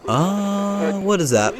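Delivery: normal talking voice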